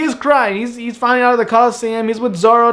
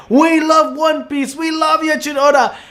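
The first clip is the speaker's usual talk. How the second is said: high, held-back cry of devotion; pitched-up fans' cheer; mock fan scream; 7 semitones higher than usual. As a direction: call it out to the sky without real volume. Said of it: "willingness to scream out into the sky".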